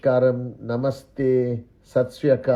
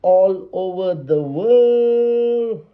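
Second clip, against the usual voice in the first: drawn-out hype greeting; 8 semitones higher, slower than usual. Real, not acted. Signature opening greeting of live stream.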